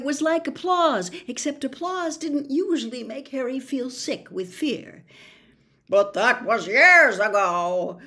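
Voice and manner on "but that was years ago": loud, strange fretful spider voice; fretful old spider. Acted. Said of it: "said Aragog fretfully".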